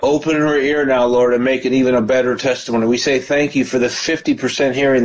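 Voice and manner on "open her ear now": normal talking voice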